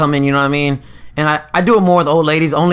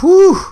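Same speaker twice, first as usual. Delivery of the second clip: exaggerated whew; 12 semitones higher, 5 dB louder than usual. Exaggerated relief to managers.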